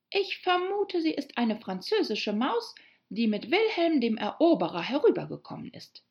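vermutende Alice. Acted. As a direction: muse aloud as Alice, starting high. Story figure Alice thinking. (grübelnde, hohe Mädchenstimme)

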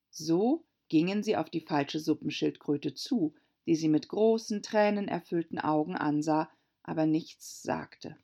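plain reading voice

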